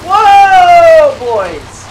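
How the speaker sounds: long, high, shouted "whoa"; loud excited whoa. Real, not acted. Gamer startled on the normal road, loud excited whoa. Near miss in the driving game.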